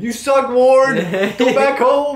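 high, loud heckler yelling; jeering taunts. Retold or imitated. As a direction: jeer at the batter from the stands. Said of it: "the guy behind us was heckling".

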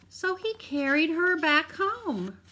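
usual speaking voice

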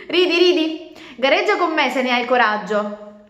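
defiant challenge. Acted. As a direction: dare him to race. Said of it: "guardandolo in malo modo". (sfida rabbiosa)

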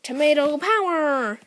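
high, loud, drawn-out cry; villain battle cry. Acted. Tomato Man triumphant after an attack, hammed-up battle cry. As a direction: stretch it out like a cartoon attack call.

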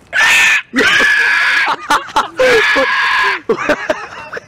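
shrill high dinosaur shriek; velociraptor screech. Pretend. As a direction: screech like a raptor. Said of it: "Do that screech".

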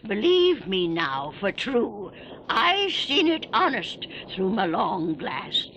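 put-on spooky old keeper spinning a tale; creepy old sea keeper. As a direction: relish every grisly detail. Old keeper tells horror tale.

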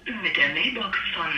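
muffled phone-line voice; quiet voice through a phone; over the phone. Real, not acted.

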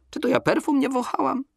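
put-on high, pert girl's retort. A narrator's reading. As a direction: toss it back like a sassy girl. Donka retorting.

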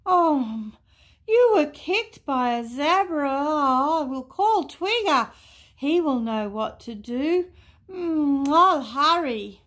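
loud, high animal character voice; high, worried storybook voice; flustered Boo-hoo. A narrator's reading. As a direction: fret aloud, pitched well up. Boo-hoo frets over kicked monkey.

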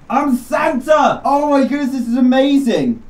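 delighted announcement; gleeful shout. Real, not acted. Realizing he is Santa.

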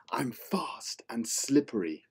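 whispered, sly monster line; sneaky whisper. Acted. Slippery monster teasing kids in a sneaky whisper. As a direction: hiss it secretly to the kids.

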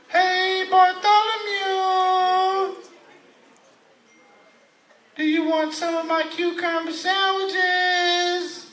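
very high, long-held singsong vowels; mock rich mother calling sweetly upstairs in song; rich mom. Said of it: "that's how rich people talk".

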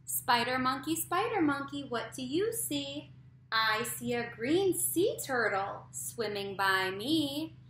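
slow, loud sing-song read-aloud; reading to a toddler. A narrator's reading. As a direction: read it slow and bouncy for little kids. Reads picture book aloud to kids.

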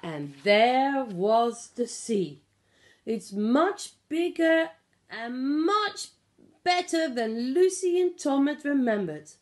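ordinary delivery

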